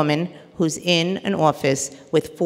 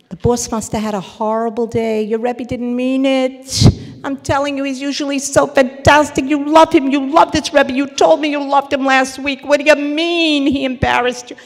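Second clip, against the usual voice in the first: dismissive reassurance; 6 semitones higher, 5 dB louder than usual. Retold or imitated. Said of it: "here's the other friend".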